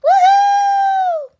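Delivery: high, loud party cheer; excited cheer. Real, not acted. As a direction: cheer it out, thrilled.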